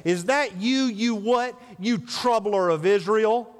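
accusing. Retold or imitated. Ahab accuses Elijah.

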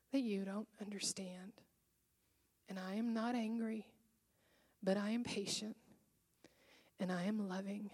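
soft, hushed, tender reassurance, whispered at times; gentle whisper of comfort to a grieving Amy. Retold or imitated. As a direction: speak softly as a patient, loving God. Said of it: "in his gentle voice say".

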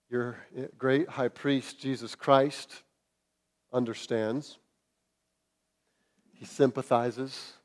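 gentle pastoral reassurance; tender. Real, not acted. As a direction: comfort them quietly. Comforting the weak.

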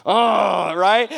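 usual speaking voice

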